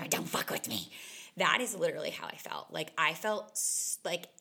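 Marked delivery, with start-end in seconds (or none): 0.1-0.9 a breathy, voiceless low snarl; cornered dog snarl. Retold or imitated. Imitating a scared shelter dog in the corner, voiceless snarl. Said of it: "and it's just like".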